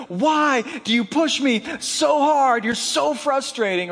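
frustrated outburst. Retold or imitated. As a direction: vent it like a fed-up youth pastor. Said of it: "he called me frustrating".

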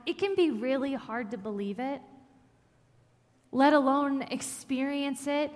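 ordinary talk

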